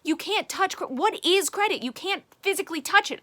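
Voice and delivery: high, loud mock panic; panicked bureaucrat. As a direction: play the flustered system freaking out. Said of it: "because it's like".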